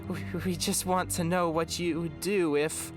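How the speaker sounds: high, stammering plea; desperate. A narrator's reading. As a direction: plead desperately, tripping over the start. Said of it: "said Subsequent desperately".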